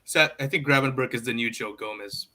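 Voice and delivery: ordinary talk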